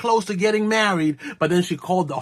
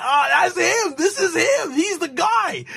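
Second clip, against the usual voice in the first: very high, eager squeal of recognition; mock excited recognition; 11 semitones higher than usual. Wedding guests spotting the famous dancer, mock excited. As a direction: imitate friends bursting to point him out.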